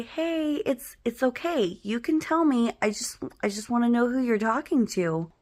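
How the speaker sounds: soothing big-sister voice, acted; gentle reassurance. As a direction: coax the secret out kindly. Reassuring frightened little brother.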